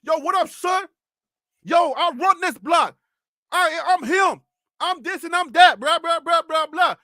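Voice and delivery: block swagger. Retold or imitated. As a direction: imitate a guy bragging on the block. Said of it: "That thing that he does".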